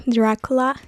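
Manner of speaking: usual speaking voice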